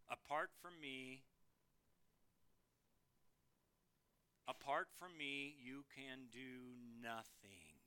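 low, soft scripture quotation; hushed solemn quote. Real, not acted. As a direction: quote it gravely, dropping away. Preacher quotes Jesus's profound words solemnly.

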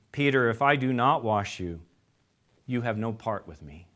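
soft, reassuring correction; gentle voice of Jesus. Acted. Jesus answering Peter gently.

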